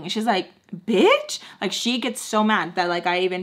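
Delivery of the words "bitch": high, loud one-word retort; indignant mimicry. Sam snaps back after being called out, high indignant mimicry. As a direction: act out an affronted comeback, pitched up. Acting Sam's indignant comeback.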